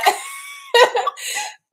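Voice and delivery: high, loud, bursting laugh; cracking up mid-sentence; burst of laughter. Real, not acted. Laughing at her own joke.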